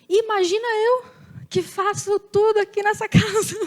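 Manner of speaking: one-up the complaint like a put-upon mother; playful martyr-mom imitation, breaking into a laugh; imitating indignant mother. Mother's one-upping retort, retold.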